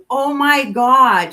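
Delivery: slow, high, loud oh my God; stunned. Retold or imitated. Meeting the nurse who treated her, slow loud astonishment. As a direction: let the shock sink in.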